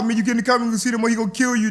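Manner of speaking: frantic warning. Retold or imitated. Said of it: "LeVon all".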